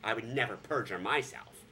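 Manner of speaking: ordinary talk, nothing marked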